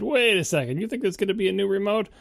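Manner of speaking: high-pitched snarky disbelief. Retold or imitated. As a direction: retell a snarky challenge, pitched well up. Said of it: "I was feeling particularly snarky".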